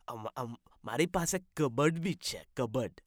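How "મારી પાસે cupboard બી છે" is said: fast, rattled off Gujarati line; rapid Gujarati. Acted. Guest repeating his Gujarati line quickly. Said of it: "speaking different language".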